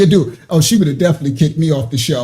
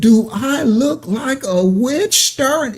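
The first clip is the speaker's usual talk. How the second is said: slow, higher mock imitation of the host; slow higher-voiced mockery of the TV host; imitating Nancy; 5 semitones higher, slower than usual. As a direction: drag each word out, lightly mocking her. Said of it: "she said".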